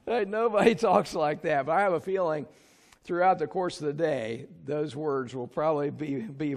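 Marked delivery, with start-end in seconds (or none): 0.0-1.7 laughing. Real, not acted. Laughing at the cheesy lyric.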